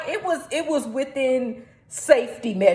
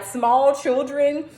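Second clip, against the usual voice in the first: ordinary talk, nothing marked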